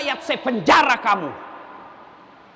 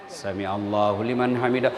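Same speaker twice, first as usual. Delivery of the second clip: low imam recitation; 5 dB quieter than usual. Retold or imitated. Imam leading the congregation, low and soft. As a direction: intone it deep under your breath like the imam.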